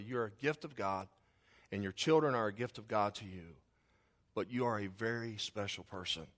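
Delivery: low, soft, sincere address; heartfelt tribute. Real, not acted. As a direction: honour them gently, with pauses. Heartfelt tribute to the mothers present.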